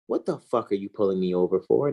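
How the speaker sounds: low, measured mock-intelligent delivery; put-on intelligent tone, pitched well down. Shown as a demonstration. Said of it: "say it in an intelligent tone".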